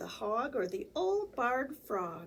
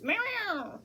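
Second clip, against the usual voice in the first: cat meow; 11 semitones higher than usual. Acted. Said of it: "who hissed".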